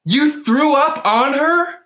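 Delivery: very high, slow incredulous question; appalled disbelief. Acted. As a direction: stretch out every word, pitched high in horror. Friend appalled at vomiting.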